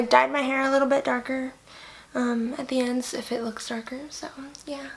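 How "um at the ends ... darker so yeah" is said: quick breathy whisper aside. Real, not acted. Aside about dyed hair.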